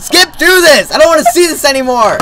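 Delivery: high-pitched, upset outburst; exasperated yell. Real, not acted. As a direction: demand it loudly, fed up with watching. Cannot bear the goal replay.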